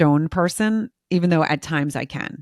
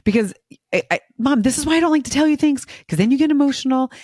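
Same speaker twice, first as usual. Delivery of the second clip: imitating a son, much higher and fast; higher, rushed teen complaint; exasperated teenage son; 8 semitones higher, faster than usual. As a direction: mimic the teen pleading with Mom. Son exasperated with crying mom.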